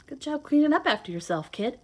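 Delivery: ordinary delivery, nothing marked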